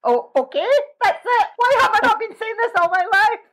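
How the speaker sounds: as if it just dawned on you; amazed realisation. Retold or imitated. Retold inner realisation, amazed.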